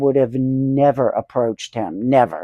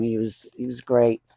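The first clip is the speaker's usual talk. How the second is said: hushed; 4 semitones lower, 6 dB quieter than usual. Real, not acted. Mourning [name]'s death.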